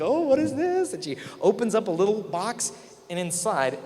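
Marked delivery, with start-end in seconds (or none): 0.0-0.9 high, bright squeal of delight; excited girlfriend. Retold or imitated. Girlfriend opening her Christmas gift box, excited high squeal. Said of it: "And she's excited".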